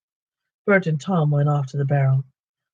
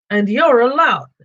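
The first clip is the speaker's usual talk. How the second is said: much higher, louder, slow insult; angry troll retort; 9 semitones higher, 6 dB louder than usual. Acted. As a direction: fling the insult back. Trolls quarrel.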